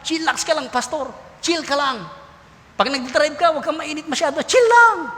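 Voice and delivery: high, breezy imitation of young people, rising to a squeal at the end; youth telling pastor to chill. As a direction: play the kids teasing the pastor to relax. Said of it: "Sabi ng mga kabataan".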